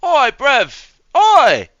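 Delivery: high, loud mock British squawk. Heckler reacting to the song, high mock British accent. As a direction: squeal it in a cartoonish British accent.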